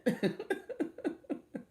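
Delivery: high breathy chuckle; real light laugh; airy laugh. Real, not acted. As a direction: laugh off the scolding. Laughing at own story.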